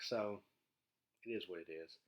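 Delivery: ordinary talk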